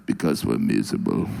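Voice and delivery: very low, dead flat gloom; deadpan Russian. Retold or imitated. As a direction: answer like it's obvious, from the bottom of the voice. Russian voiced, deadpan answer.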